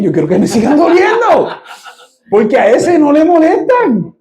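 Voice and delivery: high, fervent preacher's cry lifting the room; impassioned preaching. Acted. Pastor urging the church to embrace hardship, impassioned preaching. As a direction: preach it with rising fire, calling out to them.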